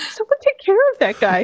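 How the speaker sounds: high, pleading mock plea; lightly voiced plea for the poor guy; pleading aside. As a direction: beg someone to help him. Speaker voices own reaction.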